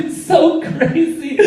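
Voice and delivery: very high, slow, drawn-out silliness; mock pop star. Pop star showing off on TV, high mocking imitation. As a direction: stretch it out in a high put-on voice.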